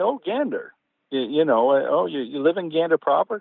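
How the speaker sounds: high-pitched imitation of eager recognition; mock keen recognition. Dad chatting to a passenger about Gander, eagerly imitated. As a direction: pounce on the familiar town name. Retold delighted recognition of hometown.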